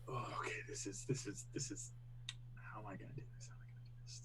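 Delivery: whispered thinking aloud; whispering. Real, not acted. Player muttering to himself.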